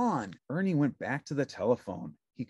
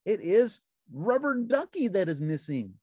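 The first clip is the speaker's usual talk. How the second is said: high, bright puppet voice; cheerful little puppet; Ernie voice; 7 semitones higher, 5 dB louder than usual. A narrator's reading. Narrator voicing Ernie answering the phone, high puppet voice.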